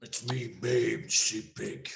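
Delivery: a hint of a gruff grown-up Babe. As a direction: talk like a grown pig who means business. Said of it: "Babe would be like".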